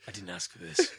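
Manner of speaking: high, put-on voice with a laugh in it; hammed-up reluctant hero, high and odd; silly strained protest, played for laughs; Shinji. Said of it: "Shinji's voice was like that".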